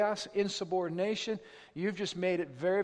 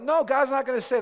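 ordinary talk

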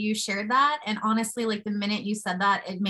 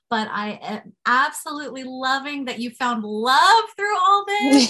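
gushing, higher and much louder; so excited; 4 semitones higher, 8 dB louder than usual. Real, not acted. Host thrilled guest found love through illness, gushing excitedly. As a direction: build to a loud, delighted peak. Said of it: "I'm so excited".

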